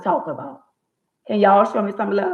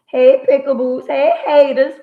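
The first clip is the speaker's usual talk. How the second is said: excited greeting; 8 semitones higher, 9 dB louder than usual. Real, not acted. Greeting fans and haters, excited.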